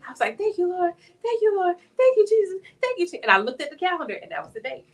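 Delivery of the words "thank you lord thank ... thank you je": high, rising louder praise; overflowing relief and gratitude; ecstatic thanks. Retold or imitated. Thanking God after being saved, high joyful praise. Retold crying thanks around apartment.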